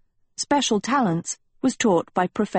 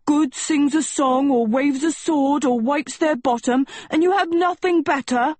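yelling; 8 semitones higher than usual. A narrator's reading. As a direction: rant at the class, pitched way up. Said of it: "Sheba yelled".

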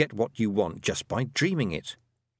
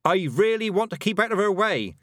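put-on high, raised boy's voice; boy shouting; 10 semitones higher, 4 dB louder than usual. A narrator's reading. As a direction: shout it after her, pitched high. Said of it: "shouted Roland behind her".

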